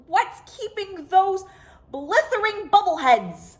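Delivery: exasperated rant. A narrator's reading. World chief fuming about his missing men, exasperated. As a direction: vent at the bubbleheads. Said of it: "the world chief flew into a rage".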